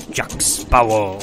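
loud, slow pirate growl. Shown as a demonstration. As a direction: bellow it like a pirate. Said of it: "in a pirate voice".

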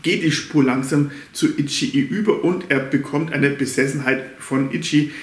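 ordinary talk

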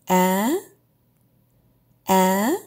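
sharp upward A; rising tone. Shown as a demonstration. Teacher demos the high rising tone. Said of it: "Start high and go higher".

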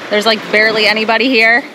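usual speaking voice

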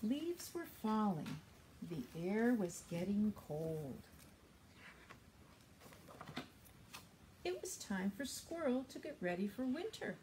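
storytime read-aloud; reading to children. Acted. Librarian reads a story to young children. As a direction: read the picture book to little ones.

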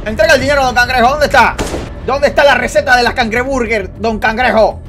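loud, insistent play interrogation; threatening demand. Pretend. Gamer demands money and recipe from don Cangrejo, threatening. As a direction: press the victim loudly for an answer.

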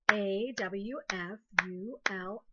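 slow, letter by letter; spelling aloud. Shown as a demonstration. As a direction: clap out each letter slowly. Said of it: "clap a syllable".

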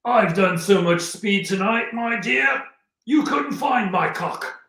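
loud, high, drawn-out character voice. Retold or imitated. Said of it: "and [name] goes".

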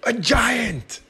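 high, loud alarmed cry. Acted. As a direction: yelp it out in panic. Terror at the giant.

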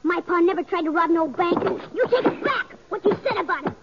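high, rising angry outburst; a boy's voice cracking with rage; furious boy. Acted. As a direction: shout him down in defence of pa. Boy defends his dead father.

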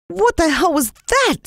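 high frightened cry; startled by the moan. A narrator's reading. Jalan hears the moan.

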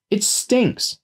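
put-on very high little-boy complaint; stubborn child. A narrator's reading. Small boy objecting to the smelly hole, stubborn.

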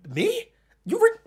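high, loud disbelief, cracking; incredulous yell. Retold or imitated. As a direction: shout in disbelief. Incredulous confrontation retold.

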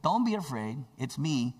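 Jesus assuring frightened disciples, emphatic; emphatic reassurance. Acted. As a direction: promise it, stretching the words. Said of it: "he had the emphasis that".

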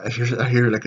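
ordinary delivery

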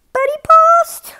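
high delighted squeal; surprised relief. Real, not acted.

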